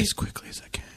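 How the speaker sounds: hushed unvoiced whisper. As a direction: whisper as if on the phone. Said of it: "Why are you whispering?".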